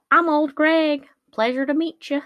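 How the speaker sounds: put-on high, loud Old Greg creature voice in an odd accent; unplaceable accent. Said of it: "I don't know what this accent is".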